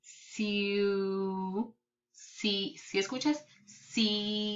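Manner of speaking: CIUDAD stretched softly into long pieces; sounding out. Shown as a demonstration. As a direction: softly draw out each part of "ciudad". Teacher sounds out the word.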